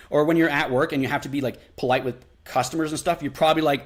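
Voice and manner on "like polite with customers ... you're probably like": normal talking voice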